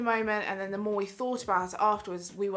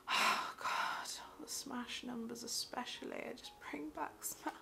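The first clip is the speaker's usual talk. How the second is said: sighed, voiceless and much softer; overcome whisper; 4 semitones higher, 9 dB quieter than usual. Real, not acted. Fan recalling the Smash numbers, overcome and whispering. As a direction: let the words fall out on a breath, overwhelmed.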